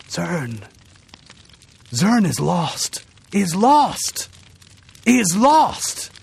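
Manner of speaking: strained dying words; with vast effort. A narrator's reading. Dying man gasping out the news, strained. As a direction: force out each word with effort. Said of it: "With vast effort, he said".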